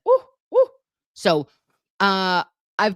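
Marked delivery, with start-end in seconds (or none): 0.1-0.7 high, loud squealing ooh, ooh; pained ooh. Retold or imitated. Stinging hydrogen peroxide on her tongue, a pained squeal. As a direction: yelp it out in pain, much higher. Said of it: "I said".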